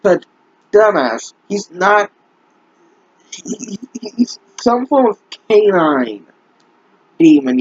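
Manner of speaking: ordinary talk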